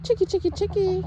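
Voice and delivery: loud high sing-song call; calling chickens. Real, not acted. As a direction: call the flock over.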